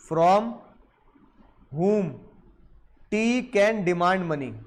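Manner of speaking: very slow, word by word, as if for notes; teacher dictates the legal question slowly to students; slow dictation. Shown as a demonstration. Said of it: "तो जज साहब ने क्या बोला".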